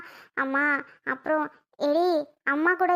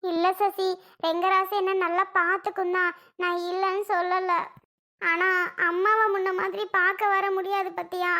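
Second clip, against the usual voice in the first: acted weepy protest; crying as she explains; tearful; 3 semitones higher than usual. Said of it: "நீ இப்படி அழுகுற?".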